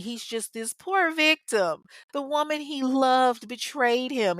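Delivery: exaggerated, slow, dripping sarcasm; sarcastic. Real, not acted. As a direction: lay on fake pity for the poor victim.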